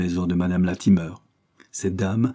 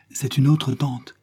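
slow, higher-pitched quick-witted protest; hushed exclamation; 6 semitones higher, slower than usual. A narrator's reading. Afi inventing an aunt for madame Latimer, exclaiming. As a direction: exclaim it without shouting, drawing it out. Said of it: "s'écria Afi à voix basse".

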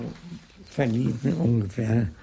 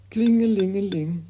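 high, loud sung ring-a-ling; imitated bell ringing; 9 semitones higher, 4 dB louder than usual.

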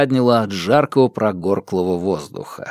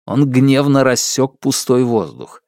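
ordinary delivery

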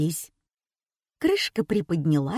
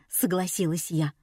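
usual speaking voice

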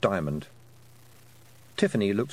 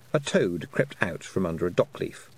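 plain reading voice

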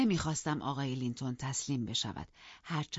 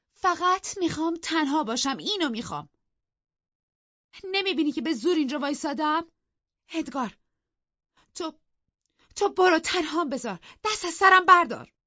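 shrill, much higher and louder, rising to a scream; furious; 12 semitones higher, 9 dB louder than usual. Acted. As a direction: drive her husband away in a rage. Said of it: "کاترین با عصبانیت گفت". (فریاد خشمگین و زیر، رو به جیغ)

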